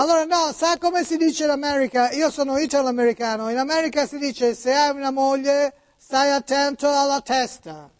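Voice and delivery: put-on Italian spoken with an American accent; high-pitched American notary on the phone. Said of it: "come parla Niculo? ... oggi americani".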